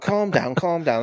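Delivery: trying to settle someone down, in retelling; retold placating. Placating the angry driver in retelling.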